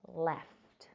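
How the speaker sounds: ordinary delivery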